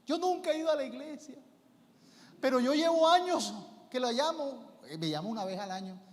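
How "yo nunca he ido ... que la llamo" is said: high, whiny mock crying; imitating a weepy woman phoning her pastor; tearful caller.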